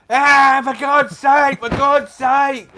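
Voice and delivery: high, loud mock shriek of frustration; exasperated shriek. Husband imitating his wife shrieking on the bike intercom, loud high exasperated. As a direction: squeal it out in exasperation. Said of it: "and Lizzie's going".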